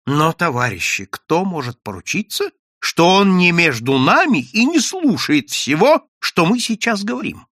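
громко и высоко, с нажимом; предостерегай товарищей с растущей тревогой; встревоженное предостережение. A narrator's reading. Встревоженная речь персонажа на собрании. (встревоженно, на повышенных тонах)

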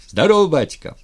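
loud, high, happy hail; joyful greeting. A narrator's reading. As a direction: greet the chief, drunk with joy. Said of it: "радостный, захмелевший от радости".

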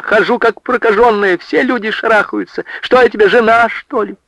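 high, exasperated wail; high lament rising to an angry question; tearful outburst. A narrator's reading. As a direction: complain bitterly, high-pitched. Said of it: "разревелась она".

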